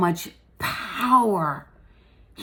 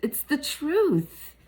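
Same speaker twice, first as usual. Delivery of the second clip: emotional; 6 semitones higher, much slower than usual. Real, not acted. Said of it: "I'm getting emotional about it".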